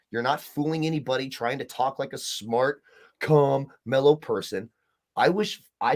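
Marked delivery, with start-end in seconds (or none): none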